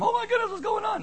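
fast, very high mock-panic squeal; panicked overreaction. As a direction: mimic someone freaking out, hammed up. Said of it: "It's like the guy with the broken arm".

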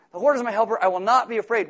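loud, high repeating of the verse; repeated declaration. Shown as a demonstration. Preacher showing the anxious repeating it. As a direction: say it again and again like a mantra. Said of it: "you won't understand a word you're saying".

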